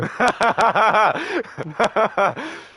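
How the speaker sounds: high, loud burst of laughter. Real, not acted. Gamer bursts out laughing at the friend's romance joke. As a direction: crack up out loud.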